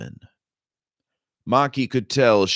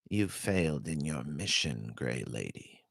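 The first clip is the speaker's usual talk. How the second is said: soft and lower, cold as paper; cold, soft, papery; 4 semitones lower, 11 dB quieter than usual. Acted. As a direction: let the verdict fall quietly, coldly. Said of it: "The woman's voice was cold, soft, almost papery".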